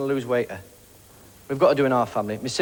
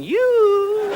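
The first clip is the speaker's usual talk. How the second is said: very high, drawn-out "yoo" hoot; polar bear's high call; 4 dB louder than usual. Acted. Polar bear hailing the comic back, high long hoot. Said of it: "there's a polar bear going".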